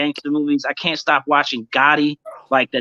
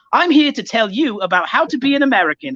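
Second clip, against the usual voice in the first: high, lightly put-on English accent; mock English accent; 7 semitones higher than usual. Trevor Noah selling American life in a mock English accent. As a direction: imitate a British presenter in an English accent. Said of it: "He'll be like".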